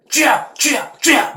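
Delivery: loud, high repeated cries; a hammed-up frantic prayer shout. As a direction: hammer each cry harder. Acted fervent shouting prayer.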